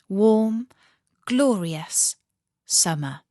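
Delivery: ordinary delivery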